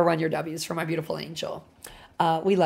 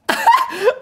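high squeal laugh; 6 dB louder than usual. Real, not acted. Laughing at herself.

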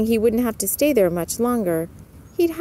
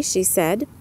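ordinary delivery

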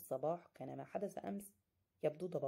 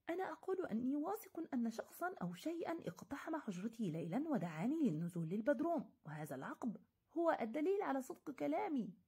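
high, raised angry protest; furious shout; 7 semitones higher than usual. Acted. Narrator protesting angrily to the doctors, voice rising high. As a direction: insist he is not lying. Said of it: "صرخت في غيظ".

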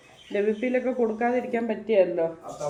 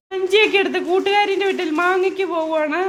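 excited teasing; 9 semitones higher, 7 dB louder than usual. Real, not acted. Mother teases son leaving.

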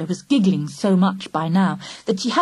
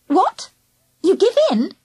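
mock surprise; 11 semitones higher than usual. A narrator's reading.

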